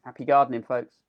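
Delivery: normal talking voice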